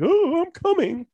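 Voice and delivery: high squeaky excited cry; goofy Pee Wee Herman voice. Retold or imitated. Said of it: "He's like".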